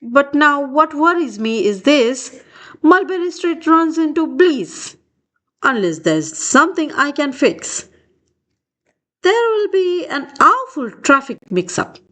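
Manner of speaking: ordinary delivery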